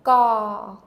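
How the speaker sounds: "Ga" said loud, stopped at the back of the tongue. Shown as a demonstration. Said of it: "I'm using the back of my tongue to stop the sound".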